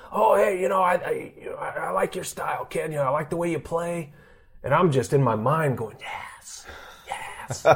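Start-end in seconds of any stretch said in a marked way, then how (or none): 0.1-4.1 higher, softer put-on voice of a friendly old scout; butter the young catcher up like an old scout; old scout's voice. 6.0-7.7 breathless whisper of secret triumph; whispered yes. Retold or imitated. Inner thrill at scout's praise.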